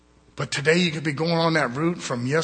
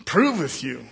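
ordinary delivery

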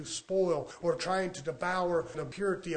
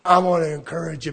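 ordinary delivery

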